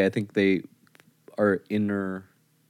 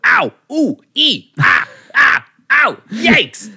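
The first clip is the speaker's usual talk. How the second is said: high, loud hammed-up ows and yikes; cartoonish cries of pain; mock pain yelps; 11 semitones higher, 5 dB louder, much slower than usual. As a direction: yelp at every bump on the way down. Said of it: "I go".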